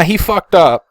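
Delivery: normal talking voice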